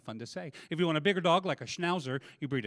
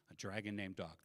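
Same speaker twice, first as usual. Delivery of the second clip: softer, musing "A dragon named dog."; quiet repeat; 10 semitones lower, 14 dB quieter than usual. Pretend. Comic quietly savouring the phrase a dragon named dog. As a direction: savour the phrase again, under the breath.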